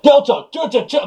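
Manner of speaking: high, urgent mock radio call; repeated high-pitched code call; radio call imitation. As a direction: imitate a guard calling over the radio. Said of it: "like, on the fucking radios".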